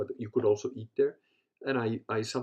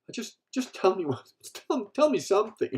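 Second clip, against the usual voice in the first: high, louder mock begging; imitated pleading; 10 semitones higher, 6 dB louder than usual. Neighbor begging him for dating tips, pleading. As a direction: plead haltingly for any scrap of advice.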